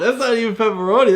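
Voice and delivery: high, fast surprised exclaim. Real, not acted. Dad reacting to the hot dog on the pizza, surprised. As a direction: react with amused disbelief.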